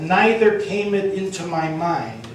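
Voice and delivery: ordinary delivery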